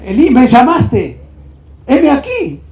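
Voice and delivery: loud, high acted call. Boy reports to the old man, calling out. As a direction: call out to rouse a sleeper.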